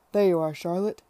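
loud, hearty hail; hearty greeting. Acted. Tom spotting Charlotte, loud hearty greeting. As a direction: boom out a cheerful welcome.

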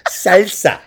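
"Salsa" with a Spanish-style a; Spanish pronunciation. Retold or imitated. Girlfriend ordering in the restaurant, Spanish "Salsa". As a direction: imitate her careful "Salsa". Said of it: "My girl's like".

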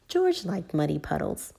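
plain reading voice

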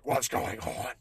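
raspy unvoiced demon growl; lightly voiced grouchy demon. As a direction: let a grumpy morning demon speak. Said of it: "Demon [name] came out".

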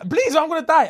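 hammed-up high plea through a laugh; mock pleading. Pretend-dying man begging his friend, mock pleading. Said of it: "I'm crying now 'cause I'm scared".

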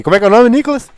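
loud, high call across the room. Real, not acted. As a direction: shout the question to Nicolas. Calling loudly to friend.

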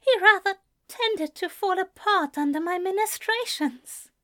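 high-pitched character voice; Ezra, breathlessly amused. A narrator's reading. Ezra telling Anthony about the dream, breathlessly amused. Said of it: "Ezra chuckled breathlessly".

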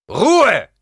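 loud, high commanding shout; thundering. A narrator's reading. Paul silencing the old woman, thundering. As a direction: thunder the order. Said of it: "donnerte Paul".

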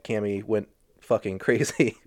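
usual speaking voice

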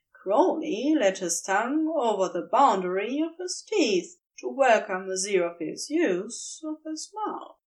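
plain reading voice

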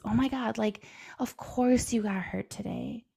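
usual speaking voice

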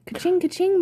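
high cash-register ka-ching; coin chime sound. Pretend. Celebrating the coin.

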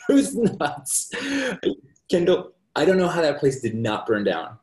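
high, loud, laughing exclamation; amused disbelief. Real, not acted. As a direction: crack up while recalling the chaos. Laughing recollection of wild house.

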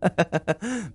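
light high chuckle; soft laugh slipping out in relief; quiet, rising giggle; involuntary laugh. A narrator's reading. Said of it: "不自觉地笑出声了".

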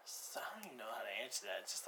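soft, breathless whisper. Real, not acted. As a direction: whisper it to yourself, stumped. Guest is stumped by the question.

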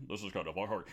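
low, soft confiding remark; imitated discreet undertone; awkward aside. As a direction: drop it low and quiet to a companion. Quotes Roger's aside to him.

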